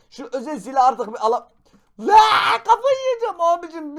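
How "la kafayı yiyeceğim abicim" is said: high, loud cracking shriek of frustration; losing it over a missing weapon, screaming; frustrated shriek. Real, not acted. Gun vanished, player losing it.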